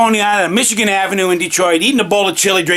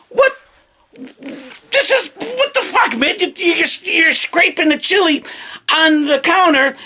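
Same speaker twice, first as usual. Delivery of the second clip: incredulous; 7 semitones higher than usual. Retold or imitated. Confronting the chili scraper.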